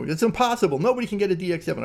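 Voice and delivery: imitated protest, high and quickening; disbelieving protest. Acting out friend's disbelieving protest.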